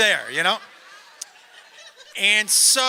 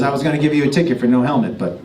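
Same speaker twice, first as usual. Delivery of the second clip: lower, dry cop voice with a laugh; lightly voiced cop; 10 semitones lower, 8 dB louder, faster than usual. Cop in his car telling the comic off, lightly lower. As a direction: hand down the verdict like a deadpan cop. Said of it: "And he goes".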